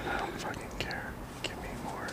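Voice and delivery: hushed greedy whisper aside. Pretend. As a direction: whisper it like a secret demand.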